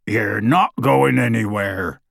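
slow, gruff growl of a giant. A narrator's reading. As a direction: growl it like a big gruff man. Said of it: "growled Hagrid".

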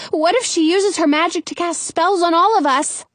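high, worried question; panicked question. A narrator's reading. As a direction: ask it in a mild panic. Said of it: "I ask in a panic".

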